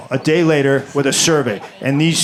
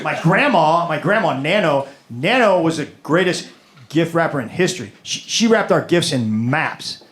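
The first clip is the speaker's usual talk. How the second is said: usual speaking voice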